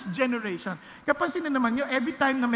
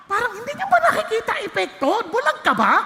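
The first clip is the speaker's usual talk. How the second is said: scolding rhetorical challenge; 8 semitones higher, 4 dB louder than usual. Real, not acted. Preacher rebukes the congregation.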